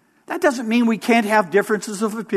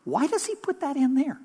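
puzzled listener; 6 semitones higher, 7 dB quieter than usual. Retold or imitated. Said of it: "And you go".

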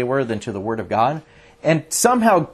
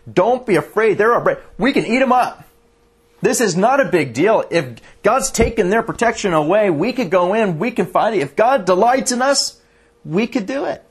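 higher, eager and bold, urging the people on; excited rallying cry; 7 semitones higher than usual. Acted. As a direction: pump the crowd up: we can take them. Voicing Joshua and Caleb's rally.

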